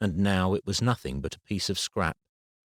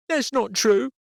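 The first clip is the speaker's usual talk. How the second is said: put-on much higher, louder child's retort; a boy defending himself; child snapping back in an argument; defensive boy; 5 dB louder than usual. A narrator's reading. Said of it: "a far more defensive attitude".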